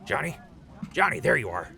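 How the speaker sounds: high, loud call across the room. Acted. Crispy calling to Johnny across the camp, loud and high. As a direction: call after him to catch him.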